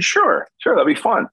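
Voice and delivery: high, eager yes; excited. Retold or imitated. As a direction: jump at the offer, pitch way up. Eagerly accepting an on-air invite.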